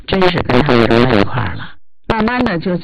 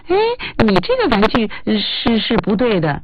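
对小孩的说教语气; 7 semitones higher than usual. Shown as a demonstration. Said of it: "不是这种语气的哈".

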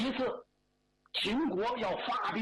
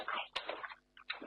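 whispered sigh; 9 dB quieter than usual. Acted. Whispered aside before confiding.

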